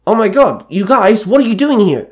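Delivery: high, loud delighted call-out; hint of glee. A narrator's reading. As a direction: call out to friends with surprised glee. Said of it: "called out in a hint of glee".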